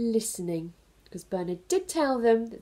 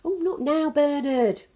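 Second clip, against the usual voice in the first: busy mum; 9 semitones higher, 6 dB louder than usual. Acted. Mum brushes off Bernard, too busy to listen. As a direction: wave him away without looking. Mother dismisses monster.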